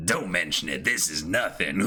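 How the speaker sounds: low, swaggering voice; low-voiced shipwright shrugging off praise; proud shipwright. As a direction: brush off the thanks like a tough guy. S1 voicing the shipwright brushing off thanks.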